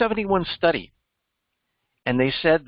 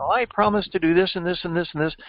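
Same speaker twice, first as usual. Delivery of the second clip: rattled-off, fast politician promises; mock politician; 2 semitones higher, much faster than usual. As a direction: gabble through the empty promises. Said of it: "they're saying".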